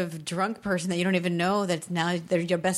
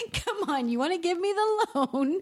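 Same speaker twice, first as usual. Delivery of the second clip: high teasing plea; mock cajoling; playful coaxing; 8 semitones higher than usual. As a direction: wheedle playfully in a raised pitch. Acts out tickling the banker.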